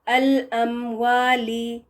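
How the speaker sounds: الاموالی very slow with a rounded w; careful Arabic w. Shown as a demonstration. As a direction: stretch الاموالی, w from rounded lips. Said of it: "اگر واو بیچ میں آتا ہے". (عربی لہجے میں آہستہ الاموالی)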